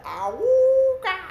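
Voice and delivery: high, loud, slow honking awooga; bagpipe honk. Acted. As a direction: honk it out like a silly horn. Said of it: "And the bagpipe said".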